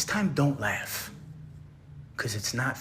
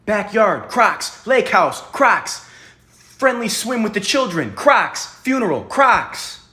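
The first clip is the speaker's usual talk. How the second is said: much higher and louder, sing-song mockery; mocking recital; 9 semitones higher, 10 dB louder, slower than usual. Interrogator loudly parodies Ben's Crocs tweet back at him. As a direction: parrot his joke back in a loud mocking singsong.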